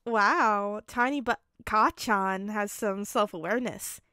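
loud, impressed announcement; declaring. A narrator's reading. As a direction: announce it to the room. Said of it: "Kaminari declared".

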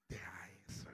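a quick unvoiced whisper; hushed command. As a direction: whisper the order.

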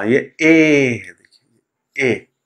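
ए held very long and slow; a slow, demonstrated ए; drawn-out ए. Shown as a demonstration. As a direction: stretch the ए vowel. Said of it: "teacher demonstrates vowel ए".

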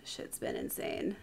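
low whispered aside; whispering. Real, not acted. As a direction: whisper it, worn out. Tired aside after chaos.

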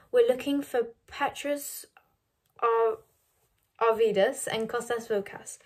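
ordinary delivery, nothing marked

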